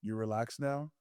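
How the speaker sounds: low, soft private question; hushed imitation of a whispered check-in; retold whisper. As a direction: ask it quietly, just for one listener. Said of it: "he whispers".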